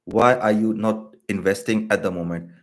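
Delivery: normal talking voice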